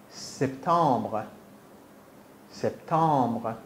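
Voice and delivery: سپتامبر said slowly with a French uvular r at the end, the p barely sounded; slow teacher model of سپتامبر, sounding the final r in the French way; French pronunciation, slowly. Shown as a demonstration. Teacher slowly modelling سپتامبر for the student to repeat. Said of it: "ما پی منو تلفظ، توجه کن".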